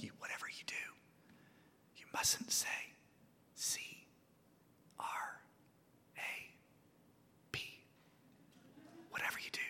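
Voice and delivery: spelled out in a breathy whisper. Retold or imitated. South African lady whispering a warning to the huddled team. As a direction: whisper it so nobody overhears. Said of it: "leaned in and whispered".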